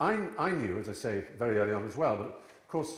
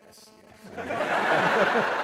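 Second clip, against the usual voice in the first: high, loud laughing yes; amused mother; 12 semitones higher, 13 dB louder than usual. Retold or imitated. Mother's unbothered reply retold.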